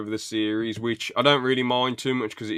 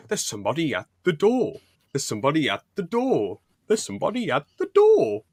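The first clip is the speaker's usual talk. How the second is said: building mock announcement, rising higher; quoting Douglas, getting more worked up; imitating Douglas; 6 semitones higher than usual. Fan quoting Douglas at the door, building up.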